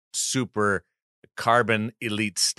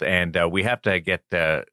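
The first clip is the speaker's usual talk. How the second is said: ordinary delivery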